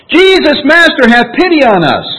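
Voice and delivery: high, loud plea from a distance; lepers calling out. Acted. Lepers calling out to Jesus from a distance, loud plea. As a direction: cry out to Jesus from far off. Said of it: "called out in a loud voice".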